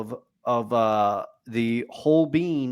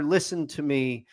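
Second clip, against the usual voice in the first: ordinary talk, nothing marked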